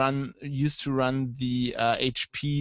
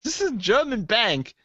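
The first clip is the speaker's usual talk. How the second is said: much higher, loud mock disbelief; incredulous clerk; 10 semitones higher, 4 dB louder than usual. Bank clerk baffled by the request, mock disbelief. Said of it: "the guy's like".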